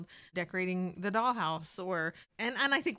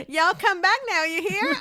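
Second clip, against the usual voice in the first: loud, high mock country accent; imitated country accent; 10 semitones higher, 9 dB louder than usual. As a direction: mimic a friend's folksy country send-off. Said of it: "with your blue mohawk saying".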